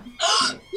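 sharp high gasp of horror; a high, appalled intake of breath; shocked gasp. Acted. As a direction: gasp as if wounded. Shocked at museum joke.

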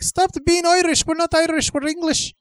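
very high, loud falsetto scolding; screechy comic mum telling off her son; scolding mother. Acted. Mother scolding her Irish-sounding son, exasperated mock falsetto.

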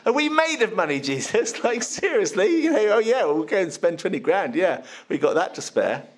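high, exasperated sarcastic whine; sarcastic disbelief. Acted. Disciples scoffing at Jesus over the cost, sarcastic. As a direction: scoff at the cost, half laughing. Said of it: "what tone of voice do you think? Sarcastically".

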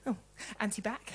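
ordinary delivery

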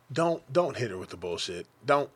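ordinary delivery

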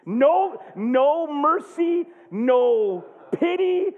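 very slow, each phrase stretched out; drawn-out emphasis. Real, not acted. Preacher laments his wife's reaction, slow drawn-out emphasis. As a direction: stretch each word for comic weight.

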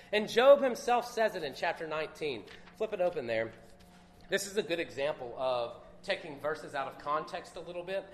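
normal talking voice

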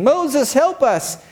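high desperate cry to Moses; crying for help. Acted. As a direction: beg for rescue, voice up high.

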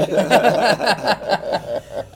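loud, rolling chuckle; hearty laugh. Real, not acted. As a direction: laugh out loud at your own story. Laughs at his own childhood memory.

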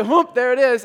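Joseph's call. Retold or imitated. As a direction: belt it out like the old man on the block. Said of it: "And he would just say".

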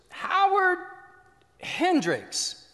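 high loud roll call; teacher calling a name from her desk. Retold or imitated. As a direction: bark the name across the classroom.

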